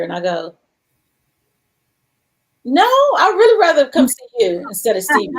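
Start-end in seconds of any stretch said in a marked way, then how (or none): 2.6-5.3 playful insistence. Retold or imitated. Jokingly declines concert ticket.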